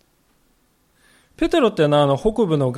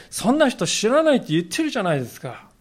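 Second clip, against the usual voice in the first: Peter's desperate denial; 6 semitones higher than usual. Retold or imitated. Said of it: "ペテロはついにここに来て". (必死に否定するペテロ)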